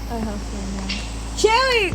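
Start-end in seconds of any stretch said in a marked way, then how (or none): 1.4-1.8 high, loud startled yelp; a sharp high cry of a name; yelped shout. A narrator's reading. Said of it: "she yelped, she shouted".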